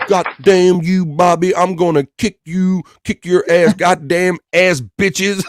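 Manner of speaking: loud, hammed-up furious scolding; mock angry rant. Retelling Wendy's chat at Bobby, mock fury. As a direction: play the outraged friend, half laughing. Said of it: "She said".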